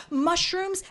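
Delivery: normal talking voice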